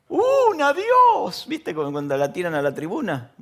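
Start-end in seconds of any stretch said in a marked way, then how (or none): 0.1-1.5 high loud cry for one chance; football player's shout. Retold or imitated. Footballer after a miss shouting at God, high and loud. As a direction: yell it like a striker begging heaven. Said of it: "Los jugadores que dicen".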